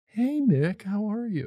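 high, lilting mock party greeting; imitated greeting. Guest greets the self-described charity case, mock-sweet high voice. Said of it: "if someone's like".

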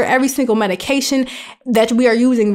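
normal talking voice

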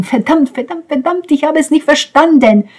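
despairing outburst. Acted.

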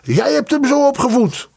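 very high-pitched, strained accusation; crying out in blame. A narrator's reading. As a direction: hurl the blame at her. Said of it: "riep Tjerajev tegen haar". (schel verwijtend roepen)

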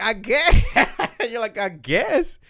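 high unsure 'I guess' with laughter; lightly mocked confused wife; confused shrug. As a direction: imitate her baffled shrug. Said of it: "I was so confused".

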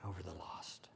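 soft, whispered, slowing down; hushed preaching. Real, not acted. Preacher quietly telling the congregation where love leads. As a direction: sink to a whisper.

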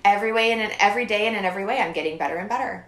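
fast, loud affirmation; rattled-off mantra. Shown as a demonstration. Reciting a self-help mantra fast and loud.

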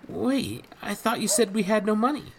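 a startled lad blurting out; young boy Jacques. A narrator's reading.

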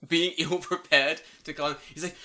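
ordinary talk, nothing marked

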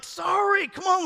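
high-pitched mock apology; protest, pitched well up, half apologizing; defensive dad. Dad caught crying by his kids, defensive protest.

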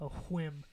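"whim" begun with a puff, hw-; audible h at the start of "whim"; breathy wh. Real, not acted. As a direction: say "whim" as hwim. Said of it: "Why are you saying it like that?".

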